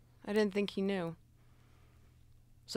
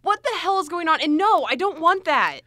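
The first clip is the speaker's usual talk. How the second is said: loud, raised-pitch outburst; agitated protest; 3 semitones higher, 10 dB louder than usual. Acted. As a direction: refuse loudly, overwhelmed. Offered a knife, panicking.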